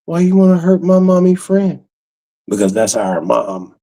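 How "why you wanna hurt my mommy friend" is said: high-pitched mock child's whine; quoted little girl. Little girl pleading for mommy's friend, high mock voice. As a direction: put on a small kid's high voice. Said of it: "She was like".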